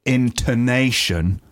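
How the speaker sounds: "intonation" drawn out slowly with a lilting tune; melodic intonation. Shown as a demonstration. Co-host demonstrating the word to his partner, slow and lilting. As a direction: stretch "intonation" out and sing its tune. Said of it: "You see the way that I did that?".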